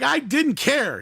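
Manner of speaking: put-on high-pitched mouthy kid retort; going off. Smart-mouthed kid to a cop, going off. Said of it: "he's just going off on the cop".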